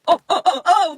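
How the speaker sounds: loud, high, strained oh; shocked gasp. Real, not acted. Reacting to the manga panel, loud shocked cry. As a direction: gasp at the scene in disbelief.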